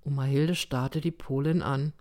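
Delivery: plain reading voice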